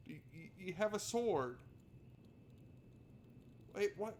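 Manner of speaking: ordinary talk, nothing marked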